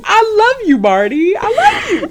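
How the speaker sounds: high, laughing declaration of love; laughing gush. Real, not acted. Fan gushing about her favorite lawyer character, laughing high. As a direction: gush through giggles.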